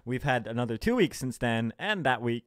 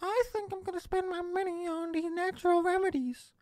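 very high put-on silly voice; mock rich person; 3 dB quieter than usual. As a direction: parody a gullible rich customer in falsetto. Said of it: "rich people that are just like".

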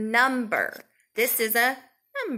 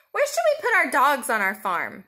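ordinary delivery

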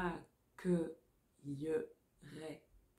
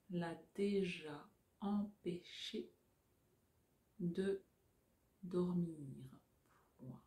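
dictating. Shown as a demonstration. Dictation chunks, softer reread.

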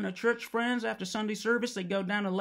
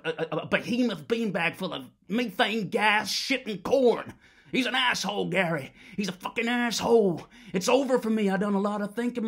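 rising and louder, slowing on shit, and corn; building to a furious shout; 3 semitones higher, 5 dB louder than usual. Acted. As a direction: work up into a full roar.

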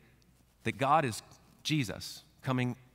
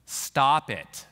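brusque, louder brush-off; dismissive parent; 2 semitones lower, 6 dB louder, slower than usual. Retold or imitated. Parent brushing off a frightened child, curt and dismissive. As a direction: wave the scared kid away impatiently.

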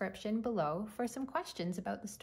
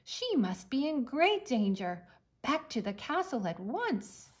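alarmed; 5 semitones higher, 6 dB louder than usual. Acted. Alarmed king fears danger.

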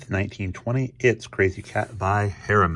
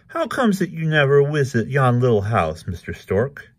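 lightly voiced cat, higher and louder; Krazy Kat; 6 semitones higher, 4 dB louder than usual. A narrator's reading. Krazy asks Mister Stork, raised character voice. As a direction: start high and fall to the name.

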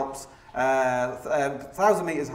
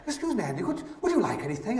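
high, softer posh hostess impression; posh woman; 5 semitones higher, 4 dB quieter than usual. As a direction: imitate a posh woman politely offering refreshments. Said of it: "this really posh woman was like".

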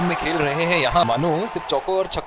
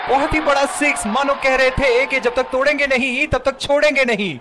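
excited; 7 semitones higher than usual. Acted. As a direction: roar the big six call. Huge six called out.

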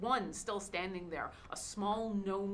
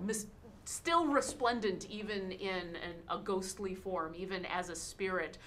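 usual speaking voice

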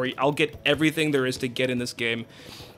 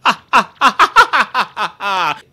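haughty laugh; 5 semitones higher, 9 dB louder than usual. Acted. Flavio laughing at Mario.

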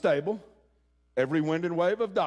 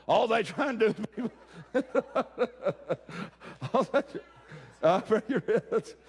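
laughing; 5 semitones higher than usual. Real, not acted. Quoting residents, breaks into laughter.

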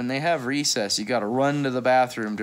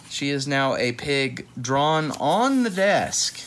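ordinary delivery

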